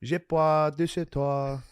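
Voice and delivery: high, drawn-out mock French. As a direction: croon fake French, slow and high. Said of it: "Every night I just speak French".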